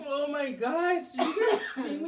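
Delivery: loud, high exclamation; loudly amazed; excited. Real, not acted. Reacting to the spicy challenge.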